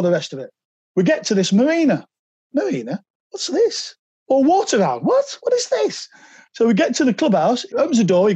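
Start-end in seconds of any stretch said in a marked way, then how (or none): 2.5-3.9 higher, softer puzzled question; baffled surprise. Retold or imitated. Players arriving at a marina instead of training, baffled surprise. 4.3-6.1 much higher, building to an incredulous What; rising disbelief. Retold or imitated. Players at the marina asking what is going on, incredulous.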